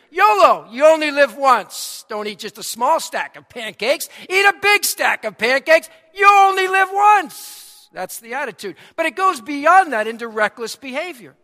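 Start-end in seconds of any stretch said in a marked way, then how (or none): 0.1-2.0 excited shout. Retold or imitated. Voicing reckless yolo attitude. 4.3-7.9 hyped shout. Retold or imitated. Mock pancake yolo cheer.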